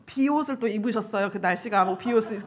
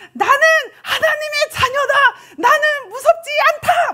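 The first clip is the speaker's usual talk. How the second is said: high, loud, declaimed battle cry; distant child's shout; 6 dB louder than usual. Retold or imitated. Child approaching on the dark path, high defiant shout. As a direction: shout it out as if from far off. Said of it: "저 멀리서".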